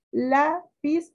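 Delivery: "Lápiz" split, LA pitched high; stress on first syllable. Shown as a demonstration. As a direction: lift LA high in "Lápiz", then piz apart. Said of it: "sílaba tónica".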